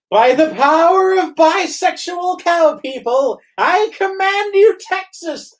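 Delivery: high, slow, booming decree; grand proclamation. Acted. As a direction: declare it like a hammy wizard. Reading a silly tweet aloud.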